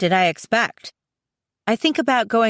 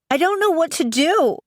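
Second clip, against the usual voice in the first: high-pitched, helpless outburst; distressed exclamation; 10 semitones higher than usual. A narrator's reading. Upset sister confiding in her friend, distressed exclamation. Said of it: "I exclaim".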